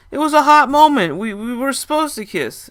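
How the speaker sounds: put-on high, loud drunk woman's voice; drunk woman slurring. Drunk woman insisting to narrator, high and slurred. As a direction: slur it out, tipsy and pushy. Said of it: "as she was slurring her words".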